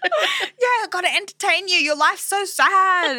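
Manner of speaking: high, slow, laughing condescension; mock patronizing. Mocking young people pitying the old, high laughing voice. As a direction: talk down sweetly to an old person. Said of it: "And they're just like".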